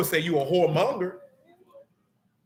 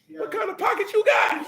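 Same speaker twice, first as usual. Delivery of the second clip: teasing shout. Real, not acted. Preacher teasing a congregant, high playful shout.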